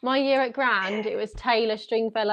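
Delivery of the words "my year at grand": ordinary talk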